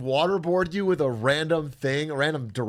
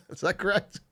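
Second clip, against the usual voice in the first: normal talking voice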